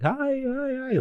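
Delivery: high falsetto hi hi hi, breezy; fake cheery greeting. Waving to his kids, hiding the injury, in a high fake-cheery voice. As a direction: pretend all is fine, squeaky high. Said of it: "I'm like".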